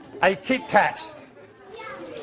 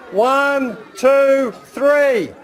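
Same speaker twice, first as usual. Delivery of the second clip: building loud count to three; loud countdown; 4 semitones higher, 4 dB louder, much slower than usual. Shown as a demonstration. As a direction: count up louder and higher. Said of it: "when I go one, two, three".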